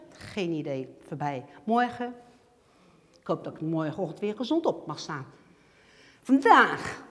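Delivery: ordinary delivery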